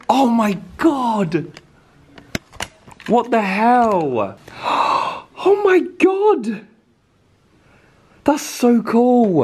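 high, drawn-out astonishment; amazed. Real, not acted. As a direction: gasp and marvel slowly at a wonderful discovery. Amazed on first seeing bedroom.

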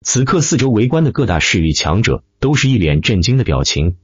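ordinary delivery, nothing marked

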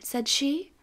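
plain reading voice

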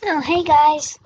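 usual speaking voice

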